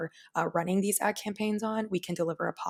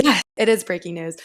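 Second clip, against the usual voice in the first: excited; 9 dB louder than usual. Real, not acted. Announcing breaking news.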